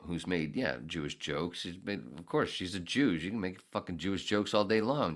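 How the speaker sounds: normal talking voice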